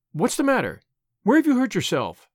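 demanding. A narrator's reading. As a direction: demand answers from a hurt child. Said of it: "demanded Missus Barry".

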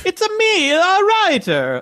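hammed-up video game plumber accent; Mario impression. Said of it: "So is it Mario?".

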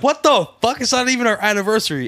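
high, loud outraged squawk; shocked wife. Retold or imitated. Wife confronting her husband over the champagne, loud and outraged. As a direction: blow up at him in disbelief.

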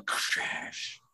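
normal talking voice

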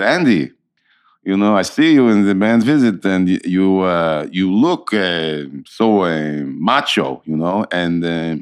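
imitated Israeli accent, halting between phrases. Actor quoting an Israeli guy's verdict, Israeli accent. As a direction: do a blunt Israeli acquaintance's accent. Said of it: "Israeli guy came who I know".

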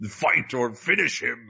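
slow, drawn-out arcade announcer; Mortal Kombat announcer voice. Retold or imitated. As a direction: announce it like a fighting game. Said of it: "Mortal Kombat voice".

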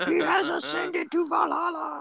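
very high, slow shouted proclamation; high slow shouted war-boy line; Mad Max war boy. Acted. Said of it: "fat, ugly guy is like".

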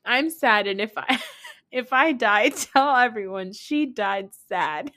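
milk the final words like a tragic farewell; mock sad Vicky. Vicky telling everyone she died sad, high slow mock lament. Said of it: "she tells everyone".

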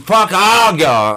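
high, loud, defiant curse; loud outburst. Retold or imitated. Newcomer cursing everyone at the meeting, loud and fed up. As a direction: lash out at the whole room, drawn out slowly. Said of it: "I may end up getting loud".

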